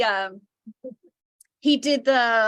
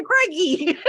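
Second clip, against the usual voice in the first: high, loud Australian exclamation; Aussie accent; 7 semitones higher, 4 dB louder than usual. Acted. Fan imitating the Crocodile Hunter, high Aussie accent. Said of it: "I love the Crocodile Hunter".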